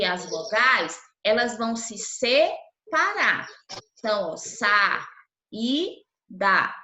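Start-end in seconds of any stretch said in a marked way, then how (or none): 4.4-6.7 sa, i, da split into three separate beats with pauses; syllable by syllable. Shown as a demonstration. Teacher to class separating syllables, clipped syllable-by-syllable. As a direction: chop saída into its syllables sa, i, da. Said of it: "Quando a gente escreve essa palavrinha saída".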